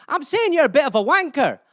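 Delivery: hammed-up Scottish lilt; Scottish accent. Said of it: "Are you not Scottish?".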